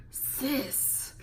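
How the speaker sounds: whispered, breathless sis. Real, not acted. Vlogger despairing over Tiffany, whispered. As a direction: breathe it out in dismay.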